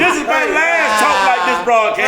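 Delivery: hammed-up offended rant; mock outrage. Mock outrage at the deep dick joke.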